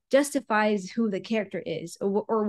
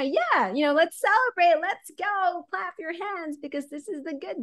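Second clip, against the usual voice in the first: higher, excited crowd hype; hyping the crowd; 7 semitones higher than usual. Retold or imitated. Ring announcer hyping the crowd for a baby face, excited and higher. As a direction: pump the crowd up for the good guy. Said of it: "the person coming out right now".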